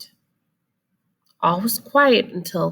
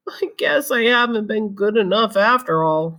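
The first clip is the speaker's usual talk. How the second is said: sad; 4 semitones higher, 3 dB louder than usual. Acted. Disappointed Splat on Christmas.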